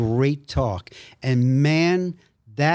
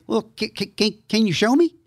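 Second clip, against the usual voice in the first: higher, stammering Texas preacher; thick Texas accent; 8 semitones higher, faster than usual. Retold or imitated. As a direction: Texas pastor with a high voice. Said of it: "had this thick Texas accent".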